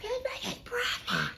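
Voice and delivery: very high, stunned exclamation; shocked disbelief. Retold or imitated. Woman discovering the other girl is pregnant. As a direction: shriek the news in disbelief. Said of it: "So I'm like".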